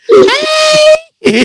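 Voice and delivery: bright high cheerful "Hey!"; happy shout. Retold or imitated. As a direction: greet like an overjoyed cashier. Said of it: "the lady sounded so happy".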